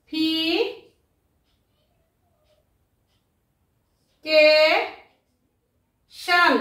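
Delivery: ক্লা, সি, ফি, কে, শান with long pauses; each syllable of ক্লা, সি, ফি, কে, শান standing alone; split into syllables. Shown as a demonstration. Teacher counting five syllables in a word, syllable by syllable. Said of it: "এই শব্দটার মধ্যে আমি কটি syllable পেলাম".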